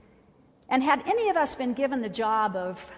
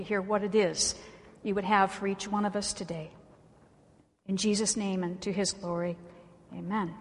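prayer; 4 semitones lower than usual. Real, not acted. Preacher asking God's help to hear the word, in prayer.

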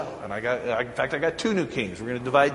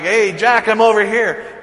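louder, higher call across the park; 8 semitones higher, 10 dB louder than usual. Retold or imitated. Dad calling out to his lost son in the park, louder. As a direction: call out so he can find you. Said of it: "I'm like".

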